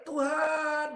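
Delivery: high, drawn-out call without real shouting volume; retold cry to God. Preacher reliving his scream before the fire, crying out. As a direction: call out to God on one long high note. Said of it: "Saya hanya teriak".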